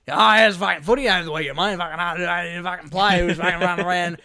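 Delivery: loud, excited bloke babbling footy talk; rambling delivery driver. Retold or imitated. Imitating the delivery driver rambling about footy, loud and mocking. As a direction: ham up a chatty tradie who can't finish a thought.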